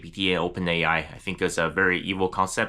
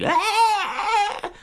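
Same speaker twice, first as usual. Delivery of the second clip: very high, sustained mock shriek; high-pitched squeal of mock craziness; crazy mock scream; 4 dB louder than usual. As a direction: imitate a hysterical woman screaming. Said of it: "crazy all the time".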